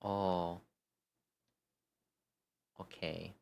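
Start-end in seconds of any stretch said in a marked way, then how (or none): none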